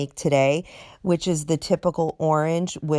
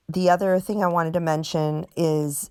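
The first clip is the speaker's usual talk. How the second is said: usual speaking voice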